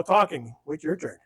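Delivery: ordinary delivery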